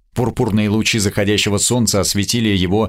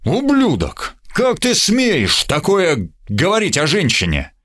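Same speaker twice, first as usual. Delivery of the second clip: outraged, much higher cry; boiling over; 9 semitones higher than usual. Acted. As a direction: erupt in sudden fury. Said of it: "Бернард вскипел". (вскипев от ярости)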